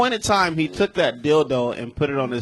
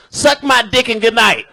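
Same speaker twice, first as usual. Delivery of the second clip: loud, raised-pitch stage shout; retold brash sign-off; 7 semitones higher, 6 dB louder than usual. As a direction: holler it as a defiant closer. Retelling his defiant set closer.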